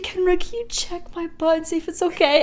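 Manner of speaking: put-on high pleading kid voice; childish plea. Asking auntie to check the sting, high pleading voice. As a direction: beg like a little kid. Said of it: "I was like".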